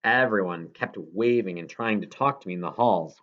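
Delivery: ordinary delivery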